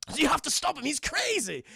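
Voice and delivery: high, cartoonish begging; hammed-up cowardly whine; mock-frightened plea. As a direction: plead from behind a protector. Joker hiding behind Batman, begging.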